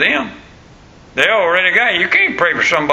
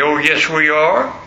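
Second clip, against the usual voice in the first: ordinary talk, nothing marked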